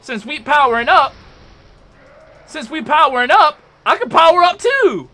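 loud, high, hyped-up trash talk; boastful challenge. Real, not acted. Answering boss powering up.

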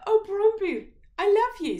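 very high, loud, swooning princess. A narrator's reading. Princess swooning over the beggar Brombu. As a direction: gush with adoration. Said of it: "said the princess".

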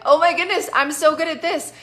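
high, loud, excited mock exclamation; giddy imitation of proud delight; delighted amazement. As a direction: act out being thrilled with yourself. Voicing listener's delight at succeeding.

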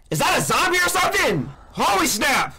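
yelling at a monster that appears on screen; panicked shout. Real, not acted.

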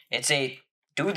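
ordinary talk, nothing marked

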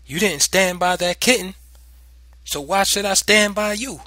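loud, high mocking challenge; taunting. A narrator's reading. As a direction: taunt the frightened child. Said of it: "she asked tauntingly".

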